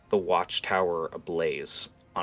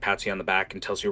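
ordinary delivery

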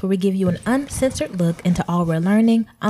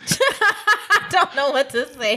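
laughing; 7 semitones higher, slower than usual. Real, not acted. Embarrassed on mic, laughing.

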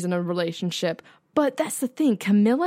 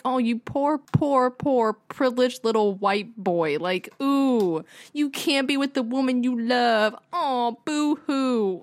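drawn-out, sarcastic boo-hoo sympathy; mock pity; 4 semitones higher, slower than usual. Viewer mocking Charles moping over Camilla, sarcastic pity. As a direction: coo with exaggerated fake sympathy.

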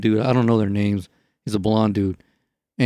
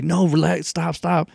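higher-pitched imitated plea; mock urgent plea; 6 semitones higher, slower than usual. Tightrope walker begging police not to cut the rope, mock urgent plea. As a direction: plead urgently, retelling someone else's alarm.

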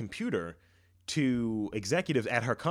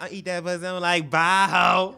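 high, loud cocky come-on; brash loud shout; 7 semitones higher, 10 dB louder than usual. Pretend. Brash pickup line.

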